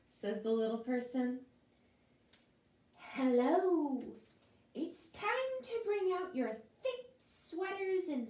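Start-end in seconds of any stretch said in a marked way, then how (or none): 3.0-8.3 high crisp chill voice; lightly voiced chilly breeze; chill in the air. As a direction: chill warning about sweaters, high voice. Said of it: "says the chill in the air".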